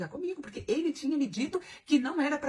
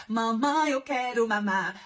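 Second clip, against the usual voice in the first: put-on slow whiny baby voice, louder; crying baby; 5 dB louder, much slower than usual. Mocking her date like a crying baby, slow whiny plea. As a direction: mimic a little boy begging for his mother. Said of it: "igual menino quando tá chorando".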